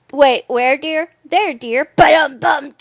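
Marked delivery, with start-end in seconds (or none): 1.9-2.7 loud vocal drum sting; ba-dum-bum comedy sting; mouthed rimshot. Pretend. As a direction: punch out a joke rimshot. Vocal rimshot after a pun.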